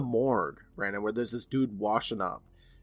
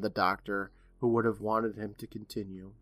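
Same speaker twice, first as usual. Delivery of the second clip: usual speaking voice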